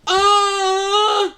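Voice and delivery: very high, loud yelp; hammed-up yelp of pain; cartoon pain shriek; pain scream. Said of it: "And he goes".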